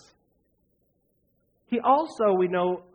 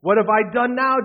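indignant protest; 6 semitones higher, 9 dB louder than usual. A narrator's reading. David's protest read aloud.

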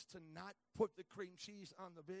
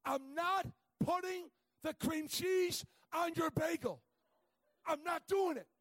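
loud, slow, high shouted refusal; mock angry cashier; 10 semitones higher, 15 dB louder, slower than usual. Cashier refusing the bagel order, hammed-up loud shout. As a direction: bark it back like the stubborn cashier.

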